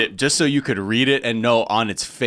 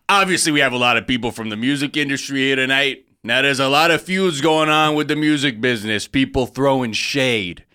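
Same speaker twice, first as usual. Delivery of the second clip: put-on Jersey guy accent; 2 semitones higher than usual. Comedian imitating the host's Jersey accent for friends. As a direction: play the host's seating-chart joke Jersey style. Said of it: "[name] doing Sebastian".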